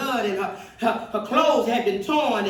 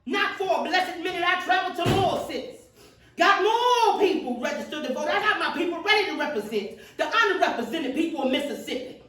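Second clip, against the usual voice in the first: a civil rights speaker rousing her audience; preaching at full force; 6 semitones higher than usual. Acted. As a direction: build the fire and demand an answer. Rousing speech to the crowd.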